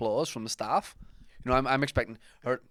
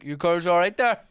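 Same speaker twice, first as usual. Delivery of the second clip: higher, louder overfriendly call; mock concerned check-in; 6 semitones higher, 8 dB louder than usual. Shopper checking on the stranger, overfriendly and louder. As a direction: chirp it like a nosy helper.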